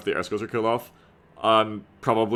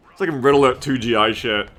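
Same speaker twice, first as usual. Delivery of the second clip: usual speaking voice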